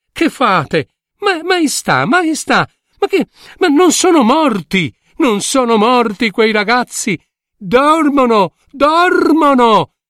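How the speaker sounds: acuta, agitata, rallenta su dormono; insisti, non sono morti!; vecchietta concitata. Acted. Vecchietta annuncia che dormono. (la vecchietta rivela al re che dormono: acuta e concitata)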